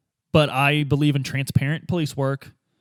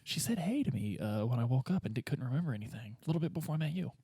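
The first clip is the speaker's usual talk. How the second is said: much softer, murmured; soft aside; 9 dB quieter than usual. Real, not acted. Player murmuring about the lady, much softer.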